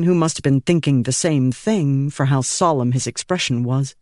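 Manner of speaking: plain reading voice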